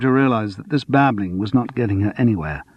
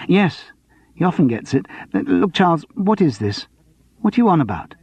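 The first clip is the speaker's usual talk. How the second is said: higher, anxious woman's voice; Sandra voice; 7 semitones higher than usual. Acted. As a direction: voice the flustered box office manager.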